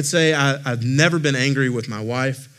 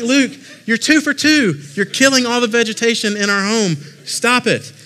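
mock anger; 10 semitones higher, 5 dB louder than usual. Dad fake-scolds son for killing plants, high hammed-up yell. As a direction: pretend fury that isn't real. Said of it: "But not really".

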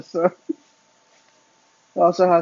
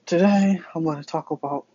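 ordinary talk

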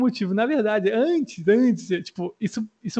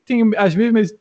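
ordinary talk, nothing marked

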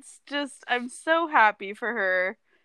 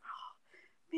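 shocked whisper; 23 dB quieter than usual. Retold or imitated. Retelling her shocked reaction.

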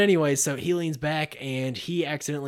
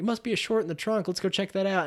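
lightly voiced cop, pitched up; higher voice for a traffic cop; imitated cop; 6 semitones higher than usual. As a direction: play an officer casually offering to investigate. Acts cop pulling them over.

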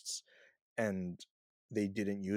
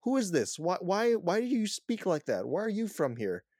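high, louder string of baffled questions; incredulous questioning; 10 semitones higher, 4 dB louder than usual. Retold or imitated. Confused viewer questioning the movie's accents, incredulous. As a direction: act out your exasperation, pitched well up. Acted bewildered questions at film.

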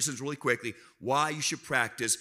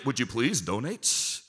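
mock-polite request; 4 semitones lower, slower than usual. Acts out an overly polite request.

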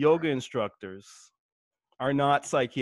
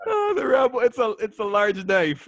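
laughing; 9 semitones higher, 4 dB louder than usual. Real, not acted. Laughing at the answer.

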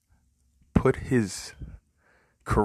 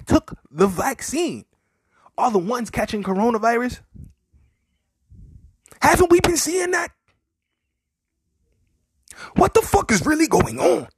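rising indignation; 12 semitones higher, 5 dB louder than usual. Real, not acted. Ranting, escalating indignation.

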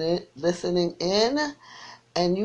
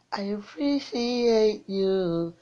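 slow stretched thanks; 4 semitones higher, much slower than usual. Real, not acted. Host stretches his thanks to caller.

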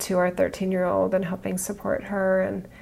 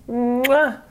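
loud smacking kiss sound; blowing an air kiss; 4 semitones higher, 4 dB louder than usual. Real, not acted. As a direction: blow the audience a kiss.

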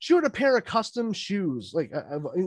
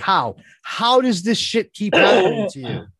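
loud, incredulous demand; acted-out astonished outburst; exasperated disbelief; 6 dB louder than usual. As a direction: burst out in baffled frustration. Exasperated question to wife, retold.

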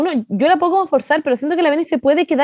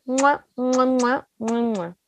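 kiss sounds; 3 semitones lower, 6 dB quieter, much slower than usual. Pretend. Blowing kisses, fading away.

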